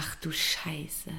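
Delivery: slow, stunned whisper; a slow, hushed gasp of shock. Real, not acted. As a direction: whisper it in disbelief. Shocked reaction.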